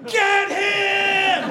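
high shouted rallying cry; mob yell. Retold or imitated. Crowd turning on the comic, high shout. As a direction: egg the mob on.

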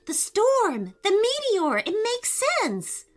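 high, rapid-fire exclamations; excited realization. A narrator's reading. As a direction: burst out as it all clicks. Boy jumps up, realizing.

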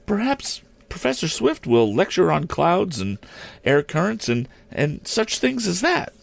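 high, lightly acted shy schoolgirl. A narrator's reading. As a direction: suggest it shyly in a high girlish voice. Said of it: "the girl went on shyly".

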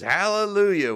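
high, loud mock hallelujah cry; blues-guy hallelujah. [name] chiming in after the speech, exuberant mock hallelujah. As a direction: belt it like a blues performer. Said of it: "like one of those blues".

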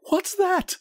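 put-on high-pitched cry of alarm from an old woman; startled old woman. A narrator's reading. Old woman starting up in bed at the knock, startled cry. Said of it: "cried the old woman, starting up".

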